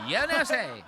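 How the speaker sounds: high, loud mocking catchphrase; Tito Jerry impression. Reactor mocking comedian's character switch, high loud impression. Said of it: "Goes right into Tito Jerry".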